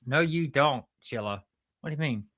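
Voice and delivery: ordinary talk, nothing marked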